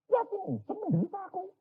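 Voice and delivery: complaining woman in labour. Acted. Woman in labour whining at her husband, high and pained. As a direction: moan at your husband in pain.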